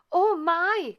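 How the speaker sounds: high, loud startled cry; a sudden flustered gasp of alarm; cried out. A narrator's reading. Fairy godmother suddenly remembering the dress, loud and high. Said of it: "cried the fairy godmother".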